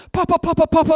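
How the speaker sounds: put-on a child running up yelling Papa; excited grandkids calling. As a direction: call out like an eager grandchild.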